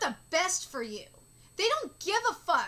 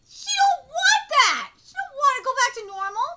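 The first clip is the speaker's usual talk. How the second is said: angry outburst; 6 semitones higher, 9 dB louder than usual. Real, not acted. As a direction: hammer home that she doesn't want it.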